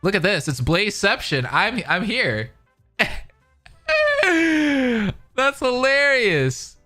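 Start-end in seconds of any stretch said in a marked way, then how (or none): 3.9-5.1 high-pitched burst of laughter; laughing. Real, not acted. Laughs at seeing himself on stream. 5.3-6.8 high, drawn-out amusement after a laugh; amused. Real, not acted. Amused right after a big laugh.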